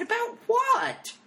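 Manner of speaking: very high, drawn-out squawk of disbelief; incredulous protest. Retold or imitated. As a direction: cry it out in shocked disbelief. Shocked protest at being evicted.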